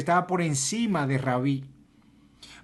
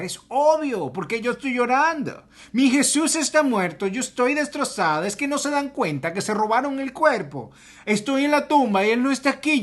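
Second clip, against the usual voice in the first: higher, louder, anguished voice of Mary; distressed Mary; 8 semitones higher, 4 dB louder than usual. Acted. Mary answers the angels at the tomb, anguished and higher. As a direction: cry out as if you lost him.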